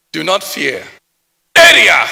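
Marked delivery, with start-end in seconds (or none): 1.5-2.1 a shouted Nigerian street hail; hollered greeting; loud street greeting. Retold or imitated. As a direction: call it out loud and high like across a street.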